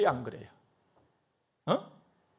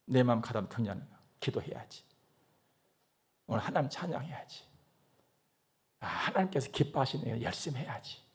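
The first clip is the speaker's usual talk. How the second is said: quiet self-encouragement; 7 semitones lower, 7 dB quieter than usual. Retold or imitated. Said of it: "내가 내 자신을 격려하고".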